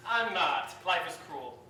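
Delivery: usual speaking voice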